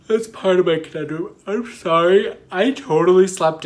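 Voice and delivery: real yawn stretching out the words. Real, not acted. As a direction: push the words out mid-yawn. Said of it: "I don't know why I'm yawning".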